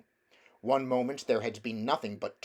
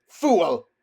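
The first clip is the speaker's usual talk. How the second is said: a sudden loud, higher cry of rage; furious shout; 7 semitones higher, 13 dB louder than usual. Acted. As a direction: bark it at the traitor like a witch. Witch shouts at Uncle Andrew.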